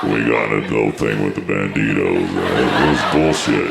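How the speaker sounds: low-pitched mock biker confession. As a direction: drop low, like a guy disguising who he is.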